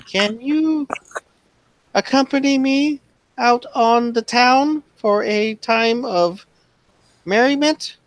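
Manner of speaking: high-pitched, formal courting voice; gentleman suitor. Acted. Mister Abel invites player out on the town, high formal voice. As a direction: woo her in a high, mannered voice. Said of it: "He's like".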